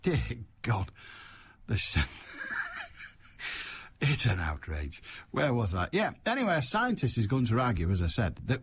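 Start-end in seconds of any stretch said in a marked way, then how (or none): 2.3-3.1 high, quiet squeal of laughter; helpless laugh. Real, not acted. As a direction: break off into high-pitched laughing. Helpless laugh mid-remark.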